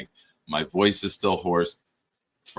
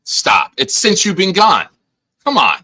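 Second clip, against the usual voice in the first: 1 loud insistence; 10 semitones higher, 8 dB louder than usual. Real, not acted. Heated argument about best song.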